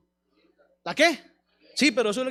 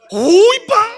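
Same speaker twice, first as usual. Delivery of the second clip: loud exclamation; 8 semitones higher, 10 dB louder than usual. Acted. Pastor demonstrates a sudden jolt.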